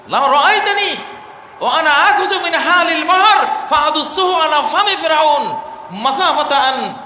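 loud, high preaching declamation; forceful Arabic quotation. Acted. Quotes Gabriel's Arabic at full force. (জোর গলায় আরবি উদ্ধৃতি)

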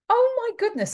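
shocked oh my goodness; exasperated disbelief. Real, not acted. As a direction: throw up your hands in disbelief. Reacting to absurd school plan.

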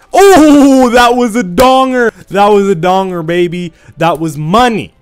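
high, loud victory yell; loud, drawn-out celebration that fades toward the end; hype shout. Real, not acted. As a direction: yell it like the best shot of the match. Celebrating a kill.

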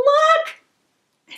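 high excited call; excited shout. Real, not acted. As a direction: call out at the find. Spotting a new gift.

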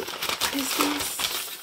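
slow, hushed whisper; awed slow whisper to herself. Real, not acted. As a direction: breathe it out in wonder, slowly. Whispering in awe at the item.

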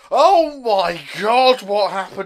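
loud, high hysterical shouting; over-the-top shriek of horror; mock panic. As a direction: yell it like a hammed-up disaster witness. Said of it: "They're not just going".

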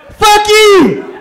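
playful shouted insult; laughing shout. Real, not acted. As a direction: bark it back at the heckler, grinning. Heckling back at audience member.

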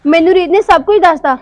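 loud angry breakup words; angry confrontation. Acted. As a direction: tell him off for betraying you. Girlfriend confronts cheating boyfriend.

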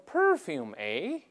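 mock-querying, pitch swinging upward; sarcastic question. Customs officer questioning a traveller about perfume, sarcastic. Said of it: "he asked sarcastically".

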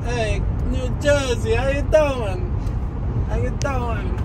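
high, drawn-out New Jersey greeting; New Jersey accent. Acted. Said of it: "I don't talk like that in New Jersey".

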